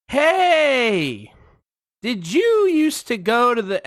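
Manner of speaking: high, loud mock hail; imitating nosy stranger. Woman to next person in line, high mock call. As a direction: accost someone in a high, pushy imitation.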